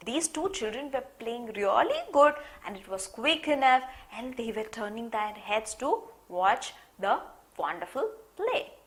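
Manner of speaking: ordinary talk, nothing marked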